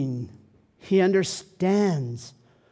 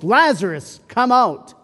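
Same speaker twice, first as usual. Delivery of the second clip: calling out loudly to the tomb; commanding call into the tomb; 5 semitones higher, 7 dB louder than usual. A narrator's reading. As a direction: raise the voice and call the dead man out. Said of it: "Jesus called in a loud voice".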